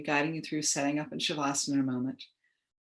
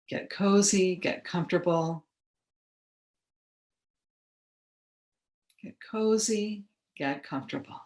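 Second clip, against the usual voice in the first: guided meditation. Acted. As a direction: ease listeners into relaxation, unhurried and measured. Leads guided relaxation.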